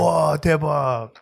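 lightly mocking wowed voice; amazed friend. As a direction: act blown away. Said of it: "He's like".

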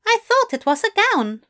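high, bewildered fussy voice; baffled angel voice. A narrator's reading. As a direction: ask in baffled disbelief.

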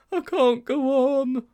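high, slow, loud gasp of defeat; winded high-voiced schoolboy; gasping, exhausted. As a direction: gasp it out like a spent runner. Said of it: "gasped Sponge".

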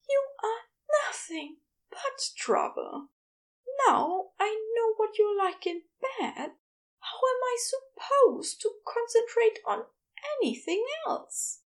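high, breathy, half-laughing; breathy teasing complaint; breathy fond exasperation. A narrator's reading. Exasperated lover's reply.

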